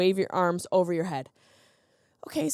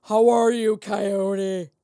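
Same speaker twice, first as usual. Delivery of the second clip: louder, direct hello at the coyote; forceful greeting; 4 dB louder, much slower than usual. Shown as a demonstration. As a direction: project it at the coyote. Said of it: "Be forceful and direct your voice".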